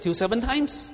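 high, loud and pleased with himself; a mock-proud Peter; eager offer. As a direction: show off the generous number.

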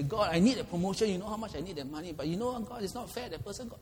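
high, pleading complaint to God; mock whining prayer. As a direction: whine at God about being treated unfairly. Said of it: "and I say".

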